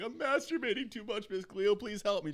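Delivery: high mock caller. Caller begging the psychic for help, high squeaky voice. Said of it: "call and be like".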